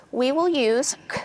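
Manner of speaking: ordinary talk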